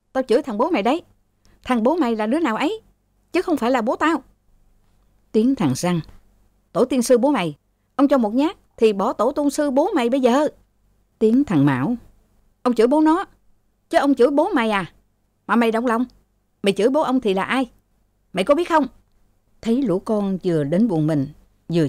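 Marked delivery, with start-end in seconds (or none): 0.1-4.2 higher-pitched taunting retort; acted brawler's jeer; cursing in a quarrel. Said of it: "nhưng lại chửi nhau". 6.7-10.5 higher-pitched angry swearing; acted brawler's abuse; cursing threat. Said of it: "nhưng lại chửi nhau". 12.6-18.9 high-pitched scornful challenge; acted sneering abuse; cursing back. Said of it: "nhưng lại chửi nhau".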